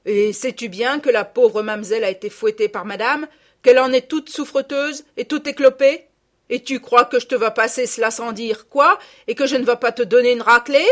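loud, rising threat; furious country mother; angry scolding. A narrator's reading. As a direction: berate your child, building to a threat.

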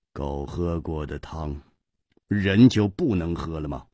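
low, soft, detached question; indifferently. Acted. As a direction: shrug it off coolly. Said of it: "淡淡地说". (姬冰燕淡淡地反问)

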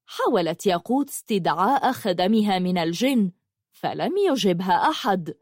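usual speaking voice

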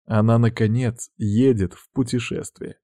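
usual speaking voice

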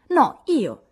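Amy insists, claiming the gift loudly; exclaiming. A narrator's reading. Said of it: "esclamò Amy".

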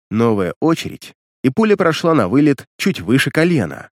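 ordinary delivery, nothing marked

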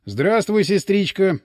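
ordinary delivery

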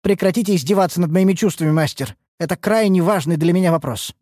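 high, indignant protest; quick burst of offended pleading; flaring up. A narrator's reading. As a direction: bristle and demand to be taken seriously. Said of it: "Ульданте вспыхнула".

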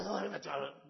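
annoyed refusal. Retold or imitated.

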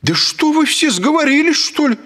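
high, loud outraged woman; indignant yelling woman. A narrator's reading. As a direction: yell in offended fury. (возмущённая кричащая женщина)

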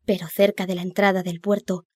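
plain reading voice

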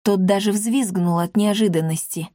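plain reading voice

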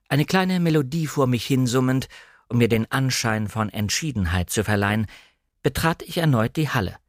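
ordinary delivery, nothing marked

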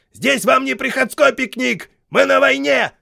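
high, loud barked order; shouting sergeant. A narrator's reading. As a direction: bark it at the ranks like a sergeant. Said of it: "крикнул шедший впереди Хейксвилл". (кричащий сержант)

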